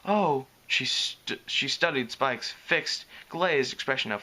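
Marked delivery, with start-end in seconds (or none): none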